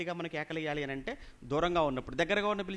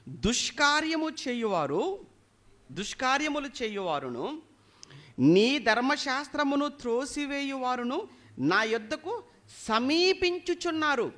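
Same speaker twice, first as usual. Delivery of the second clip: slow, raised preacher's reading; scripture proclamation; 7 semitones higher, 5 dB louder, slower than usual. A narrator's reading. Preacher reads Psalm to congregation, proclaiming. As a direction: proclaim the psalm, building higher to the end.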